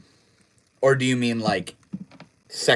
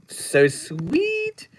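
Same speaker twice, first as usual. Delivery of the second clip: much higher, squeaky cooing; 14 semitones higher than usual. Pretend. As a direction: coo it up high like cooing at a baby. Repeats chat praise twice.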